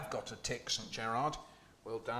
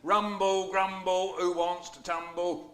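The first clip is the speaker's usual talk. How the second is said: loud, raised giant growl; booming Cyclops; 6 semitones higher, 5 dB louder, slower than usual. Acted. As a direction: boom it like a hungry giant. Cyclops voice returns.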